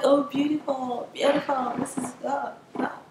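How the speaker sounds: put-on admiring classmates in an American accent. Classmates admiring her print, imitated in an American accent. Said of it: "I think that's an American accent".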